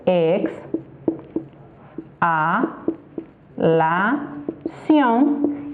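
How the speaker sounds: EX, A, LA, CIÓN each alone with pauses; syllable by syllable. Shown as a demonstration. Teacher separating a word for students, syllable by syllable. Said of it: "la separación sería".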